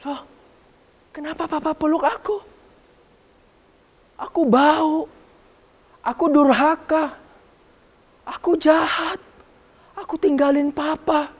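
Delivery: put-on high, pleading child voice; ashamed son. The youngest son asking why his father still loves him, ashamed. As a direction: beg in shame, a touch tearful.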